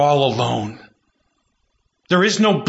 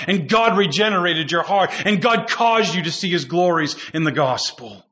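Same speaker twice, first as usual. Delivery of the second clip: ordinary delivery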